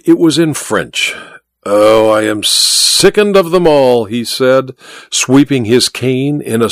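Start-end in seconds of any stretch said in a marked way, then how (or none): 1.6-4.0 a lightly voiced weary showman, faintly foreign; Malvino's voice. A narrator's reading. Magician Malvino, bored by the gaping crowd, lightly voiced disdain. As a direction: sigh it out as the jaded great magician.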